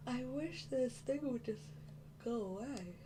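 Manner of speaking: words stretched by a yawn. Real, not acted. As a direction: talk through a yawn. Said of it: "I don't know why I'm yawning".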